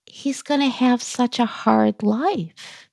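mildly tearful retelling of a fearful thought. Retold or imitated. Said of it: "So I'm starting to cry a little bit".

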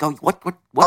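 scrunched-face mobster impression; De Niro. Co-host showing a friend the actor's face, light impression. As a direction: lightly mimic a star through a scrunched-up face. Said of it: "does the De Niro face".

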